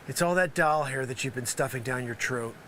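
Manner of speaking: normal talking voice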